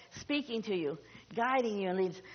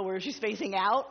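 normal talking voice

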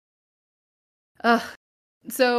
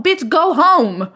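mock exasperated yell; 6 semitones higher, 4 dB louder, slower than usual. Exasperated retort to Carrie.

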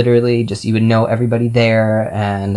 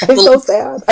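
mock sad; 14 semitones higher, 9 dB louder than usual. Mock lament after a laugh.

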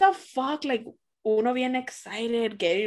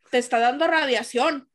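normal talking voice

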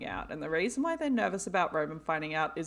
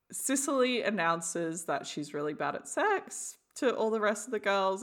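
usual speaking voice

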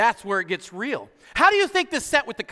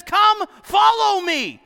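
slow, loud and high "Come follow me"; shouted call; 12 semitones higher, 7 dB louder, slower than usual. Acted. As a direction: boom out the invitation slowly. Jesus calls Matthew, preacher shouts.

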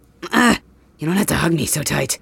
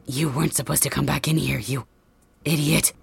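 ordinary talk, nothing marked

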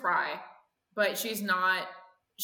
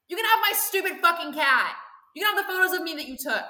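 defiant daughter; 4 semitones higher than usual. Acted. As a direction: spit it out, reckless and bitter. Daughter's defiant rant at mother.

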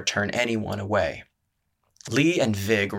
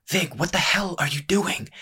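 sharp, high hushed snap at a friend; a startled boy snapping at his friend on the roof; snapped; 8 semitones higher than usual. A narrator's reading. Startled friend on the rooftop at midnight, sharp hushed snap. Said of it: "Lee snapped".

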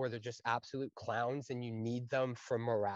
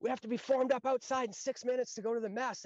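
higher, hurried alarm call; urgent course senior; 10 semitones higher, faster than usual. Retold or imitated. Course senior runs up warning the section, urgent high voice. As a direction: rush everyone out the door. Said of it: "he goes".

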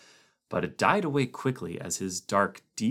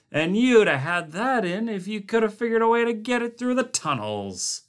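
raised, griping rant; grouchy cook; 11 semitones higher, 6 dB louder than usual. A narrator's reading. Cook griping to the camp about his crowded tables, grouchy. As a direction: list every complaint with relish.

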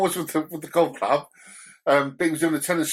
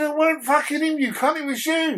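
mock angry retort; 13 semitones higher than usual. Retold angry retort to brother.

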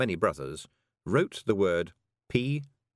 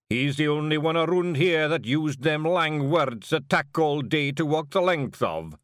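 slow, raised Scots Feegle voice; Big Yan's Scots; 8 semitones higher, 5 dB louder than usual. Acted. Big Yan mocks Rob to Jeanie, in a slow raised Scots voice. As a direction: drawl it out like a big wee warrior.

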